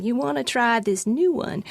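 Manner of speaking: badly faked Southern accent; playful attempt at a Southern local's accent. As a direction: keep up the amateurish Southern accent. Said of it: "That was a terrible accent".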